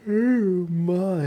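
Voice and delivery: words stretched through a big yawn. Real, not acted. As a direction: say it mid-yawn. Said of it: "that was a terribly rude yawn".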